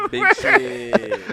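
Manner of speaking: high, loud laughing punchline. Real, not acted. Joke ends in laughter.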